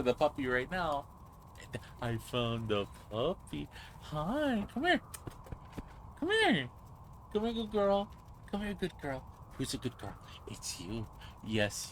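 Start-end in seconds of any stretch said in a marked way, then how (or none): 4.0-9.2 high coaxing call to the dog. Real, not acted. Calling the dog over. 9.6-11.9 softer, lower praise for a good dog. Real, not acted.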